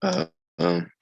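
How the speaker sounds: cracked, squeaky voice after clearing throat; hesitant filler with a high voice crack. Real, not acted. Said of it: "what's up with my voice".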